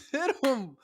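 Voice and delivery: playful re-enactment of a viewer urging her on; rooting for her. Retold or imitated. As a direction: egg the fighter on in a high-pitched voice. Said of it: "I was rooting for you".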